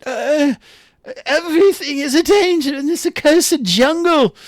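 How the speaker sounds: very high, wailing hammed-up voice; Urks lamenting. Game master as poisoned Urks begging help, tearful wail. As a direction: moan and sob theatrically in a high pitch, loud then faltering. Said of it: "but Urks is like".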